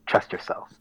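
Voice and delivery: whispered, soft and intimate; whispering. Real, not acted. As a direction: whisper it to yourself. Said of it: "repeated advice, whispered".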